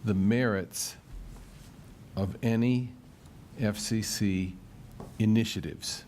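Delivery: very slow reading, broken into short phrases; slow dictation. Real, not acted. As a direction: dictate it slowly, pausing for the note-taker. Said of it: "so you can take it down".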